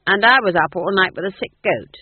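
ordinary delivery, nothing marked

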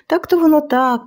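high, hesitant head-scratching voice; puzzled guard. A narrator's reading. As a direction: sound doubtful and scratch your head. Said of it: "почухав потилицю Квасило".

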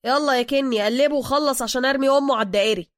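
loud, high, speeding up; impatient driver. Acted. Driver urges Kenny to finish the robbery, loud and impatient. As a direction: hurry the accomplice along loudly.

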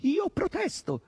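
very high, drawn-out complaint; howled protest. A narrator's reading. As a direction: wail the protest slowly and high. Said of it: "ululò questi". (protesta lamentosa e acuta)

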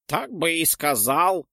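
put-on high, slow, offended old man grumble. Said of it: "обиженно проворчал дедок".

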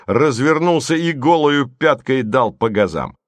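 plain reading voice

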